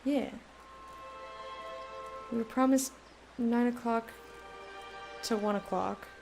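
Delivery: usual speaking voice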